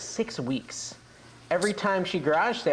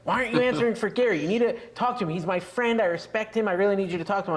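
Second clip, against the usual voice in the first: higher, hurried imitation of a nagging mother; lecturing mom; 4 semitones higher, faster than usual. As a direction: scold like mom, point after point. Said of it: "lectures me every week".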